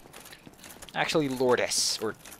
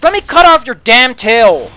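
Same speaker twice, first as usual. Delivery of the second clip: loud and higher, real frustration; frustrated shout; 7 semitones higher, 15 dB louder than usual. Real, not acted. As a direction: yell it at the monster mid-fight. Fighting the gargoyle, frustrated.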